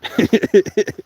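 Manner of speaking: high loud laugh. Real, not acted. Laughing at Justin's question, loud laugh. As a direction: burst out laughing.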